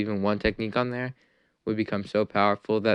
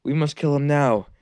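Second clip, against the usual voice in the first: louder, higher angry mob voice; villagers fearful rage; 3 semitones higher, 5 dB louder than usual. Acted. Villagers cry out to kill Naruto, rising anger. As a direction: villagers demanding the boy die. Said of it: "They're like".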